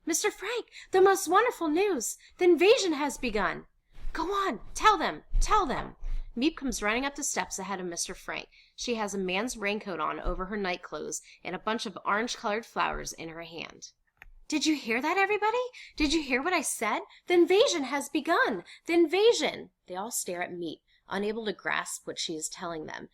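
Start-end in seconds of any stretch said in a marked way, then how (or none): 0.1-6.0 high, loud, breaking great news; excited. A narrator's reading. Miep bringing invasion news to Mister Frank, excited. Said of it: "Miep's excited voice". 14.5-19.7 high, loud and elated; thrilled announcement. Acted. Miep telling the household about the invasion, thrilled.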